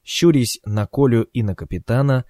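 ordinary delivery

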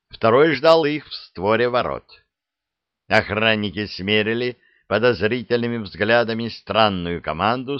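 usual speaking voice

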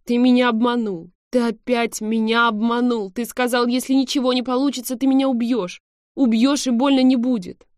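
loud, high accusing cry; guilty shouting. A narrator's reading. Heroine to the man who deceived her, guilty shout. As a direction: cry out in reproach at full volume. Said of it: "закричала виновато".

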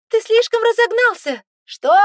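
very high loud yell; shouting over wind. A narrator's reading. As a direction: scream it over the roaring wind. Yelling over wind in jeep.

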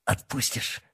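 a choked, half-whispered plea; strangled. A narrator's reading. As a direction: squeeze the words out, throat pinned. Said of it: "сдавленно спросил Толян". (сдавленный полушёпот)

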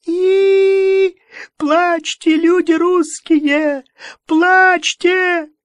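very high, loud, slow wail; wailing prophet. A narrator's reading. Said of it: "вполне натурально заверещал".

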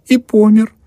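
a high, loud sob breaking through the words; sobbing. A narrator's reading. Daughter sobbing about her dead father. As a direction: let a girl's sob burst out. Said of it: "Паша всклипнула".

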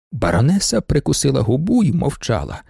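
usual speaking voice

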